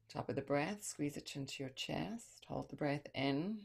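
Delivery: calm visualization voice; guided meditation. Acted.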